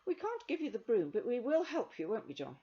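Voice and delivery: put-on young girl Rosemary.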